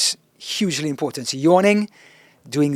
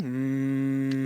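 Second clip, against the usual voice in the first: humming demo; 7 dB quieter than usual. Shown as a demonstration. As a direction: hum softly to warm up the voice. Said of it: "So humming".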